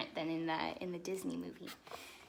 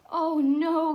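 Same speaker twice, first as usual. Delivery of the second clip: louder, higher startled gasp; 7 semitones higher, 13 dB louder than usual. Acted. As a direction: catch your breath in dismay. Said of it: "gasped Cinderella".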